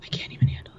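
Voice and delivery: whisper. Real, not acted. Excited whispered reaction.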